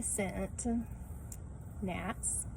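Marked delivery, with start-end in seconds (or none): none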